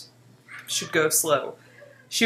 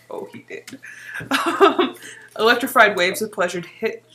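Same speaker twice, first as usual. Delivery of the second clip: plain reading voice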